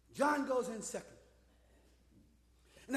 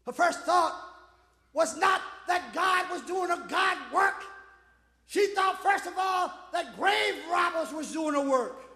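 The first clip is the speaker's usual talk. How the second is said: raised, punchy pulpit delivery; emphatic preacher, phrase by phrase; forceful preaching; 7 semitones higher, 8 dB louder than usual. Real, not acted. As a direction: preach it out to the whole church.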